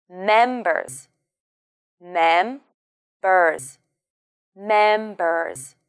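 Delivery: "Members" with the weight on "Mem"; MEM-bers, falling off; stress first syllable. Shown as a demonstration. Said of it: "the stress is on the first syllable".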